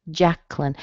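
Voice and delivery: "Jacqueline" as JAK-lin, two syllables; middle vowel squeezed out. Shown as a demonstration. Teacher showing a common variant, two-syllable "Jacqueline". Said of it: "squeeze out the little vowel sound in the middle".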